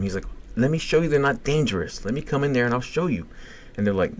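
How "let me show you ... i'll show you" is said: higher-pitched retold plea; agitated pleading. Patient asking nurses for his toys back, agitated. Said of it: "he's getting, like, really agitated".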